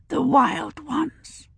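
put-on hushed child's whisper. A narrator's reading.

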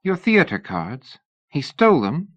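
put-on young lady Sybil, anxious. A narrator's reading. Narrator voices Sybil.

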